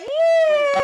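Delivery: high excited cheer. Retold or imitated. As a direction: shout it like a cheering crowd. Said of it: "they started cheering".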